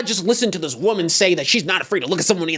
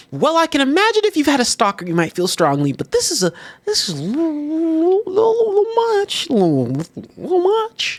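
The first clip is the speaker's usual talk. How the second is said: rising higher, stretched little much; high hedging; 11 semitones higher than usual. Retold or imitated. Pushing back gently on the driver, high squeaky hedge. His cautious reply to driver.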